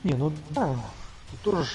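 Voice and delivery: ordinary delivery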